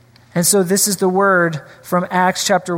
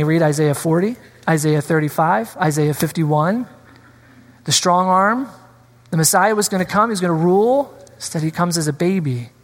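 normal talking voice

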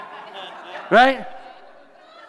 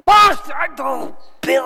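calling out; 9 semitones higher than usual. Retold or imitated. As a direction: holler it across the room. Bill shouts across church again.

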